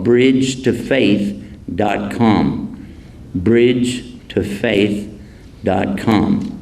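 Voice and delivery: drawn-out, deliberate address; slow it right down for writing; slow dictation. Shown as a demonstration. Naming his favorite website to the audience, slowly. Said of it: "dictates third address".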